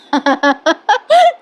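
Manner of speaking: a real laugh breaking out, rising high; can't hold back the giggle; bursting laugh. Real, not acted. Narrator to viewers, unable to stop her real laughter. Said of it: "मी माझं हसू कंट्रोलच करू शकले नाहीये".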